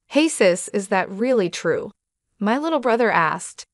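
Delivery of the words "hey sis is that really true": ordinary delivery, nothing marked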